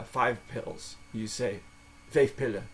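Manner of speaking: ordinary talk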